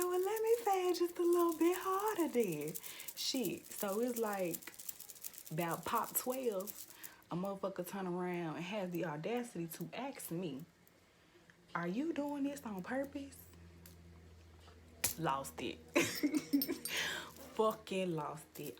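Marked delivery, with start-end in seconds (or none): 0.0-2.7 high, loud singsong retort; mock-sweet scheming. Speaker retells deciding to fan harder, gleefully petty. 11.7-13.4 high-pitched quoted question; imitating indignant stranger. Stranger turns around to ask her, mimicked indignation. 15.8-17.5 high, breathy burst of laughter; real laugh. Real, not acted. Speaker recalls how she lost it, cracking up.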